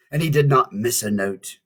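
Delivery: ordinary delivery, nothing marked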